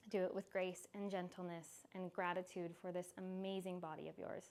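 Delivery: soft calm closing voice; guided relaxation. Acted. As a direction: wind the class down gently. Soft closing of yoga class.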